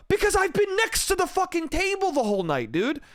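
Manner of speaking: pissed off. Retold or imitated. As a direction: re-enact losing patience mid-argument. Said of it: "he was getting me pissed the fuck off".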